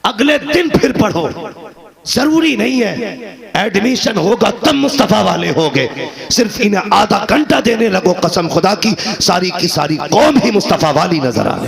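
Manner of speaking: high, loud sermon at full force; fiery preaching. Acted. Preacher rousing the congregation with fervent, loud, high preaching.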